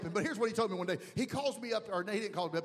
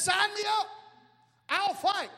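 higher, louder eager cry; eager shout; 10 semitones higher, 5 dB louder than usual. Acted. Preacher voicing David, shouting eagerly to fight. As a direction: shout it like a man ready to fight.

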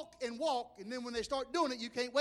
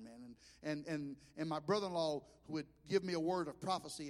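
usual speaking voice

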